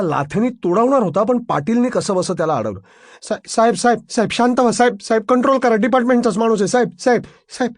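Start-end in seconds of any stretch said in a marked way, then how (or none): 3.2-7.7 high-pitched, pressing appeal; urgent restraining plea. A narrator's reading. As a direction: frantically try to hold the boss back. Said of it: "Patil त्याला आवरायचा प्रयत्न करत होता". (घाईघाईने आवरत विनवणी)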